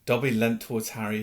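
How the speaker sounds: plain reading voice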